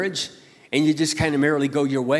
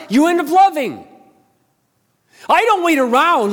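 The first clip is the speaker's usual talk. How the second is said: much higher and louder, driving the point home; full-force preaching; 10 semitones higher, 5 dB louder than usual. Acted. Pastor rouses the church about serving his wife, booming. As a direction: preach it out with fire.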